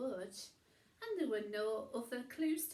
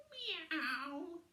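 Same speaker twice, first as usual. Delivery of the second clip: cat meow; 7 semitones higher, 3 dB louder than usual. A narrator's reading. Wilbur the cat speaks.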